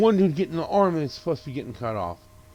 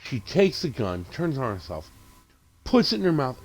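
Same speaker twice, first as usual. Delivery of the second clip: normal talking voice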